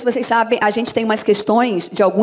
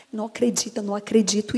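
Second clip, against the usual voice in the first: much softer, murmured rehearsal; trying it out quietly; 2 semitones lower, 8 dB quieter than usual. Retold or imitated. Dubber testing a lip-sync solution, murmured to herself. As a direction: say it under your breath.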